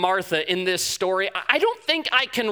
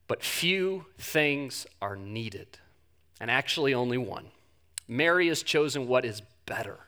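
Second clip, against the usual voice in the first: soft, low, slow and tender; calm gentle Jesus; 6 semitones lower, 4 dB quieter than usual. Acted. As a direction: speak with calming reassurance, letting pauses breathe. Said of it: "imagine this calming presence".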